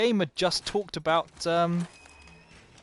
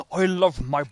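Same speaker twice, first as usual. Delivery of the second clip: playful put-on British-style accent, louder; 6 dB louder than usual. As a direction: ham up a foreign accent for the line about the brick. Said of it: "willing to do that accent".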